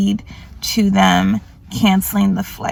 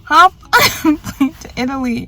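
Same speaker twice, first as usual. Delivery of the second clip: laughing; 6 semitones higher than usual. Real, not acted. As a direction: giggle through the plan. Laughs at her plan.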